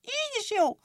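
a very high, angry clipper squeal; angry shout. A narrator's reading. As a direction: snap it out furiously in a tiny high voice. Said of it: "kiáltotta mérgesen".

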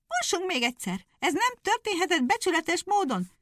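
high, outraged hare; indignant hare. Acted. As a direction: demand a rerun, cheated. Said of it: "kiáltott a nyúl".

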